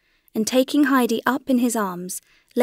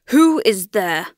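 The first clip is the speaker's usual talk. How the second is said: booming, louder challenge into the dark hall; thundering call; 6 dB louder, slower than usual. A narrator's reading. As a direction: call out sternly, as if to an intruder. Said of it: "thundered the doctor in a voice that echoed".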